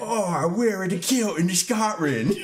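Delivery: high, giggly fake Scottish; botched Scottish accent. Joking about kilts with friends, botched Scottish accent. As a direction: badly overdo a Scottish accent, pitched high. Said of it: "doing my best Scottish accent".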